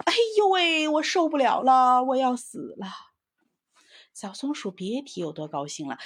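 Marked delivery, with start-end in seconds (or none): 0.1-2.9 loud, high howling complaint; tiger wailing. A narrator's reading. Chili-burnt tiger howls he can't bear it, loud wail. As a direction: wail it out, rolling on the ground. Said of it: "直叫喊".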